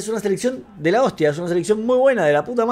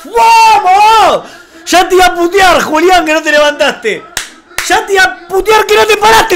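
excited shouting; 8 semitones higher than usual. Real, not acted. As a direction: yell at the player in real excitement. Festeja jugada de Julián en vivo. (aliento a los gritos, agudo)

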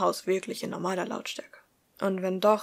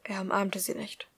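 ordinary delivery

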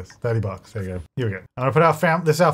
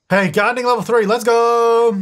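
cheering; 7 semitones higher, 4 dB louder than usual. Real, not acted. Levelling up a game skill.

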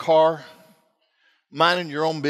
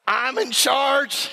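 slow, higher-pitched mock authority; retold overconfident coach, every word spread out; cocky reassurance; 7 semitones higher, much slower than usual. Husband coaching his laboring wife, mock-confident and emphatic.

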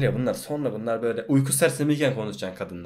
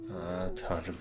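stunned whisper; 5 semitones lower, 13 dB quieter than usual. Real, not acted. Shocked after death scene.

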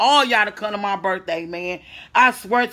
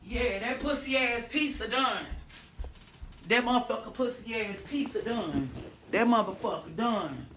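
much softer, far from the mic; 14 dB quieter than usual. Real, not acted. Streamer checking pizza at the oven, off mic. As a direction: speak from across the room.

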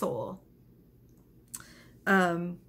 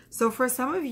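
ordinary delivery

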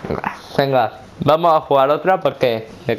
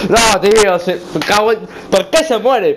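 frustrated outburst; 6 semitones higher than usual. Real, not acted. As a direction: rage at the game. Jugador frustrado al perder remontada. (queja furiosa y aguda)

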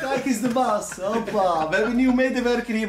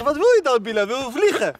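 mock exasperated; 6 semitones higher, 3 dB louder than usual. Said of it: "Ik zeg".

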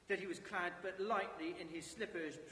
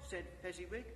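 usual speaking voice

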